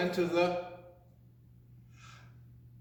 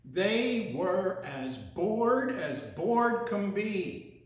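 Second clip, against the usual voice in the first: drawn out; much slower than usual. Acted.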